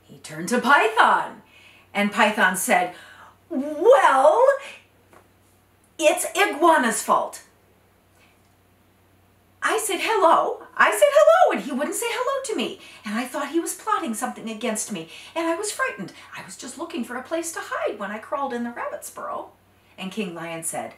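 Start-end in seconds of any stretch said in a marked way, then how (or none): 3.5-10.6 high, quick, jittery snake voice; Python, nervous. Acted. Python defends himself, frightened. 10.8-11.6 insistent hello. Acted. Python repeats hello, higher. 11.6-19.5 high, quick, jittery snake voice; Python, nervous. Acted. Python defends himself, frightened.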